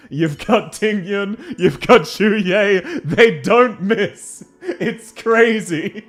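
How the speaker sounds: high, drawn-out, delighted raving; excited gushing. Real, not acted. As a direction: stretch each word as the list peaks, laughter bubbling in. Gushing climax of list of pretty characters.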